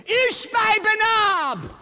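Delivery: very high, slow, loud call; a rescuer's challenge, very high and loud. Acted. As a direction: bellow his name across the field. Abishai calls out behind him.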